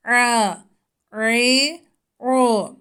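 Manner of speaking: "Ra, ri, u" squeezed out from a tightened throat; tight-throat Ayn. Shown as a demonstration. Teacher shows pupils the pressed throat sound. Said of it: "boğazımızı sıkarak çıkarıyoruz".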